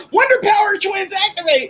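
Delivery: high, punchy cartoon-hero call; playful high-pitched heroic battle cry; superhero twins' catchphrase.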